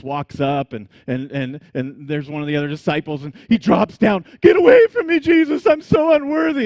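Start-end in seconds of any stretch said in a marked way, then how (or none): 4.4-6.6 high, very loud, hammed-up grovelling cry; a disciple teasing Peter by parroting him; mock Peter. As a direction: disciples around the campfire mocking Peter's old plea. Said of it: "everybody's poking Peter and laughing".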